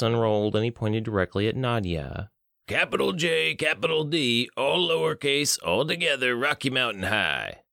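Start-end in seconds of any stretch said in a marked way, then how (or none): 2.7-7.6 dazed oracle. Acted. Benny gives password in daze.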